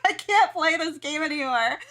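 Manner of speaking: mock surrender through laughter; laughing. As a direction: toss it off while still laughing. Still laughing, giving up.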